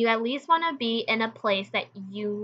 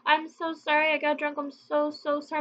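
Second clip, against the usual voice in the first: mock teary apology; 6 semitones higher, 4 dB quieter than usual. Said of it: "She goes".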